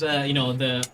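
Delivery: normal talking voice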